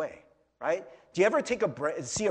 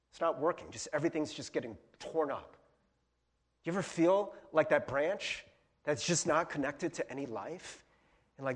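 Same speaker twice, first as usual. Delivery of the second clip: ordinary delivery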